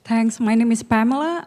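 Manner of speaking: ordinary delivery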